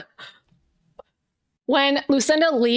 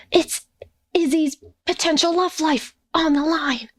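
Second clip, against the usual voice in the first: urgent confiding; 3 semitones higher than usual. Acted. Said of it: "leans in close and she's like".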